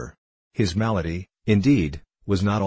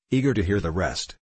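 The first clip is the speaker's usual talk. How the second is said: ordinary delivery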